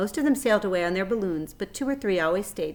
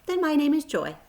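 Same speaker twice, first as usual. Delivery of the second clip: tiny spider voice; 9 semitones higher, 5 dB louder than usual. Acted. Spider names herself.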